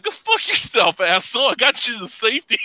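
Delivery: high, snapping mock fury; a sharp, high-pitched mock blow-up; angry retort. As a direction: bite back at the ingrate. Angry retort to ungrateful guy.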